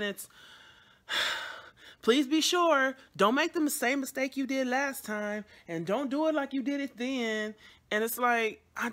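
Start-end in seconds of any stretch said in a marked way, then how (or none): 2.0-7.5 higher, louder mock nag of a worried mom; nagging mom. Mom pleading with her daughter not to repeat a mistake, mock nag. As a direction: play the fretting mother, pitched up.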